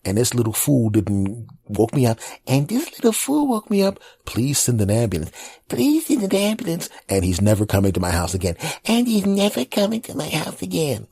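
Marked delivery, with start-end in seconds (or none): 2.5-4.0 put-on very high child's echo; little kid voice. Nephew repeating. 5.7-6.9 put-on very high child's echo; little kid voice. Nephew repeating. 8.8-11.1 put-on very high child's echo; little kid voice. Nephew repeating.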